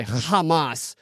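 HAMAS opened with a phlegmy throat-clearing H; throaty mock pronunciation. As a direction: cough up the H in Hamas, mockingly. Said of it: "coughing up loogies to say".

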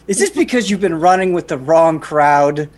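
normal talking voice

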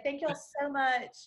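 slow, sugary Texas accent; put-on Southern sweetness, taken slowly; Southern charm, Texas. Shown as a demonstration. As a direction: draw it out with Texan Southern charm. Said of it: "It's like Southern charm, like Texas".

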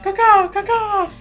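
high screeching bird call; shrill screeching bird. Pretend. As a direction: screech it like a seagull.

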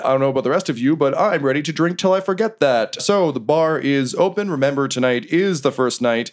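big, lilting announcer delivery; Remy. Acted. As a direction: address the crowd as the fight's emcee. Remy says, standing up.

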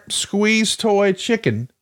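normal talking voice

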